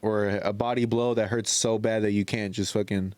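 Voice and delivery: normal talking voice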